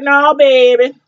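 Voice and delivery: drawn-out Southern New Orleans refusal; country New Orleans accent. Retold or imitated. Imitating the mom refusing the job, country New Orleans accent. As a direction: stretch it like an upset New Orleans mama. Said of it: "she has such a country Southern New Orleans".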